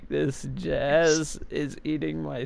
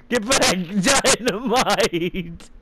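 laughing shout; 5 semitones higher, slower than usual. Real, not acted. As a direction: laugh through the warning.

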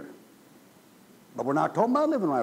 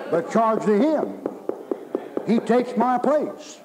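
louder, higher, slowing to hammer it home; fire-and-brimstone pulpit drive; full-force preaching; 5 semitones higher, 5 dB louder than usual. Real, not acted. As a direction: preach it hard, dragging out the last words. Preaching to the congregation.

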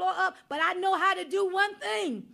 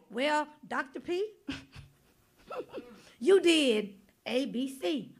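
mock judgment reading; 5 semitones lower, slower than usual. Preacher voicing the judgment book, lightly mock-solemn. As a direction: read out the record like a clerk, with a laugh. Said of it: "they're gonna say".

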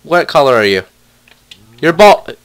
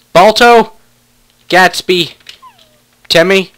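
usual speaking voice

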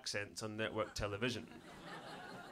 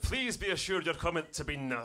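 higher, hammed-up Scots with endless rolled R; thick Scottish accent; 5 semitones higher than usual. Assuring the complainer on the phone, exaggerated Scottish. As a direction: trill the last R on and on.

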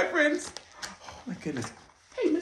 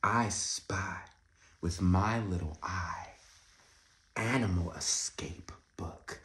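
usual speaking voice

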